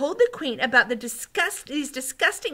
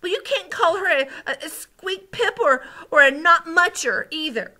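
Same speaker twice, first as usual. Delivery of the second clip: high, loud, mounting indignation; worked-up child character's protest; angrier and angrier; 12 semitones higher, 12 dB louder than usual. A narrator's reading. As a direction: argue hotly, stumbling over the insults. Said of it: "getting angrier and angrier".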